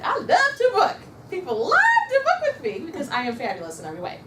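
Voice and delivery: high, loud self-hyping chant; powerful affirmation. Shown as a demonstration. Consultant psyching herself up before approaching someone, high and emphatic. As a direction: gush it as a big pep talk to yourself. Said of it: "you're saying in your head".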